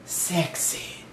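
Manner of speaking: breathy sexy whisper of the word; sultry whisper. Shown as a demonstration. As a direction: purr it softly, seductively. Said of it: "You gotta say it like the sexy Layla".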